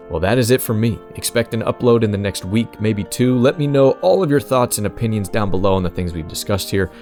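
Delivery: usual speaking voice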